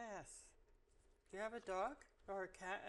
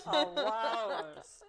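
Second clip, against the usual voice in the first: laughing it out, louder and slow; 5 semitones higher, 15 dB louder, much slower than usual. Real, not acted. Listener laughing at the seal guess, laughing loudly. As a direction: giggle through the answer.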